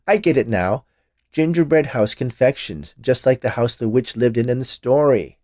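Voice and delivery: delighted he gets the joke; amused. A narrator's reading. Man amused, laughing.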